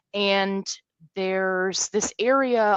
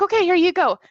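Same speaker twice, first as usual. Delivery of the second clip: high, louder cheerful hand-off; chirpy staffer; 11 semitones higher, 6 dB louder, faster than usual. Retold or imitated. Staffer hands over the baggies, high and chirpy. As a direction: sing it out like a happy helper. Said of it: "she's like".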